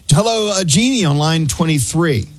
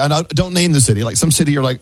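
ordinary delivery

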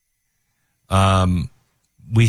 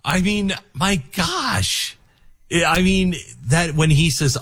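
choked up; 9 semitones higher than usual. Real, not acted. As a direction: overcome and struggling to get it out. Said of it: "brought tears to my eyes".

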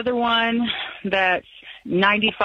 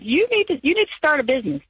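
high-pitched urging little voice; lightly acted voice of temptation, swooping high; nagging bug's voice; 6 semitones higher, faster than usual. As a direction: play the bug egging you on, pitched up high. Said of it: "this bug bit me that said".

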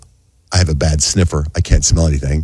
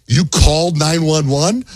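slow, higher-pitched disbelieving question; incredulous; 7 semitones higher, slower than usual. Retold or imitated. Husband learning wife called emergency services, slow incredulous question. Incredulous reply to wife's admission.

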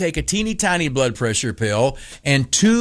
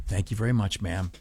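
lower, much softer gruff thanks; grumpy old man; 7 semitones lower, 10 dB quieter than usual. Retold or imitated.

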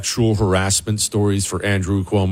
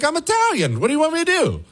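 much higher mocking Italian shrug voice; mock Cuomo. Host mocking Cuomo's excuse, in a high mock voice. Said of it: "that's what he said as an explanation".

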